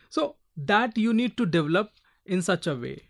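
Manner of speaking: ordinary talk, nothing marked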